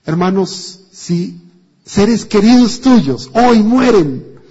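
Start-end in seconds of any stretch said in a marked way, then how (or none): 1.8-4.4 drawn out and raised, pressing the point; slow loud preaching. Real, not acted. Preacher presses his congregation with a slow, raised line. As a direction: lean on every word, slow and loud.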